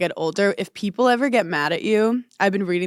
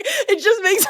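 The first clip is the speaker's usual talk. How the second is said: ordinary talk, nothing marked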